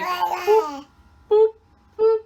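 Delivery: high, loud imitated monitor beep; mock beep. Mother mimics the doctor's beeping count, high mock beeps. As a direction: chirp it like a machine. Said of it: "So he was like".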